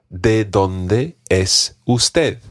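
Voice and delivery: ¿De dónde es usted? drawn out slowly, word by word; slow classroom demo of the formal question; slow, careful model. Shown as a demonstration. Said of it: "ask a slightly different question".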